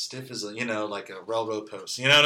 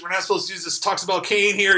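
ordinary talk, nothing marked

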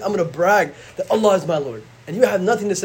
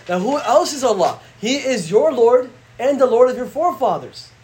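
usual speaking voice

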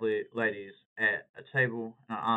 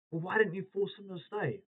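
clueless friend voice; 7 semitones higher, 3 dB quieter than usual. Retold or imitated.

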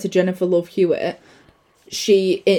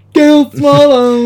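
slow, drawn-out, higher and louder; mock announcer voice; 5 semitones higher, 6 dB louder, much slower than usual. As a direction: announce the name slowly into a mic. Acted quote of character at mic.

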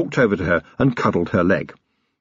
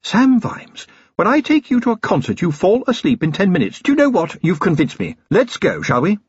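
higher-pitched, decisive lady's voice; brisk wife; 9 semitones higher than usual. Acted. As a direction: play a wife briskly taking charge of her husband. Voices Sybil addressing husband.

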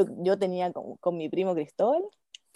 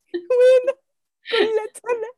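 much higher, slow, laughing through the words; high laughing retelling; 13 semitones higher, much slower than usual. Retold or imitated. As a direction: squeal it out while laughing. Retelling mom with the sandal.

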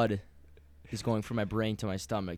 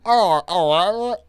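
high, loud, slow mock English accent; drawn out, lips barely moving, fake English accent; bad English accent; 10 semitones higher, 13 dB louder, slower than usual. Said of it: "don't move your mouth at all".

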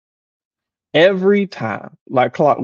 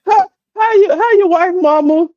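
much higher, rattling off names; pitched up, ticking through the family; mimicking mama; 13 semitones higher than usual. Son mocking his mama listing relatives, much higher. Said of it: "she just go down the line".

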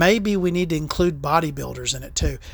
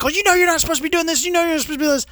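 much higher, fast mock scold; mock scolding lady; 10 semitones higher, much faster than usual. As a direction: rattle it off like the scolding supervisor. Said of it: "And she's like".

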